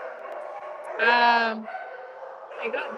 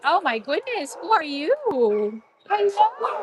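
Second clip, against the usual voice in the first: higher, sing-out hello to an animal; cooing pet greeting; 6 semitones higher than usual. Real, not acted. Shelter visitor meets a new animal, cooing high. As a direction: coo up high at a new dog.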